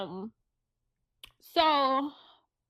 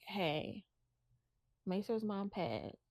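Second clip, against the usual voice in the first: soft, low, measured request; discreet phone call; 3 semitones lower, 8 dB quieter than usual. Retold or imitated. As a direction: keep it hushed and controlled, as if breaking news discreetly. Mother discreetly phones father's supervisor.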